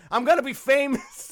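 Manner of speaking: amused send-up of an overeager actor; eager actor. Acted. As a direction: imitate a bit player banking on his big break. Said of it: "thought that was his big break".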